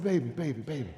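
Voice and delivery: low, soft, tender coaxing; soothing father. Retold or imitated. Father calming his crying daughter, low and soft.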